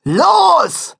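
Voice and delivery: high, loud shout. Acted. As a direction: yell it out to start the band. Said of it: "schrie Matzen".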